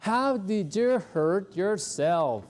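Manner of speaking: slow model question. Shown as a demonstration. Teacher reads the question slowly to the class. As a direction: stretch each word of the question slowly.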